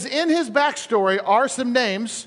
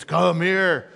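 deep giant's summons; Goliath voice; 2 semitones lower than usual. Acted. A very tall Goliath beckoning David, deep giant voice. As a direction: sink into a huge man's deep voice. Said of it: "your voice is that deep".

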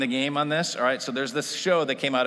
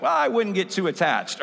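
tactless uncle; 7 semitones higher, 5 dB louder than usual. Retold or imitated. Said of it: "And he's like".

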